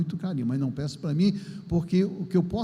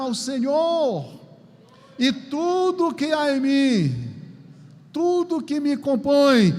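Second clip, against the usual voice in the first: higher, slow exultant declaration, ending sung; proclaiming a psalm; 6 semitones higher, 4 dB louder, slower than usual. Acted. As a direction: lift it up like worship, then sing the last line.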